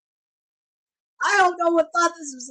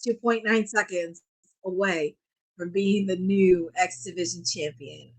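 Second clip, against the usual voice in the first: ordinary talk, nothing marked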